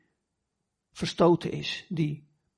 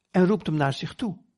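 usual speaking voice

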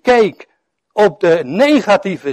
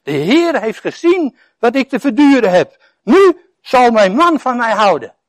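higher, louder, hopeful wife's voice; Lea hoping; 6 semitones higher, 5 dB louder than usual. Acted. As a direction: voice Lea's longing with a lift. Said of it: "Want, zei ze". (hoger en luider, hoopvolle Lea)

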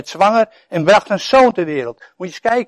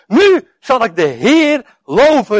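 slow, higher, louder cry of praise; exclaiming praise; 7 semitones higher, 6 dB louder, slower than usual. Acted. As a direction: Lea calls it out slowly. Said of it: "riep ze uit". (uitroepend, langzaam en luid lovend)

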